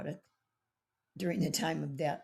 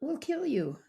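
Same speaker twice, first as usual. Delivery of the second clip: after a long pause, pitched up high and a bit louder; dramatic warning; 10 semitones higher, 4 dB louder than usual. Real, not acted. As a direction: land it like a grave verdict. Warning of deadly tick disease.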